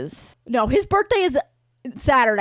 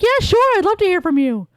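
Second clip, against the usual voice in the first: eager yes; 9 semitones higher, 4 dB louder than usual. Acted. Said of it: "And she'd be like".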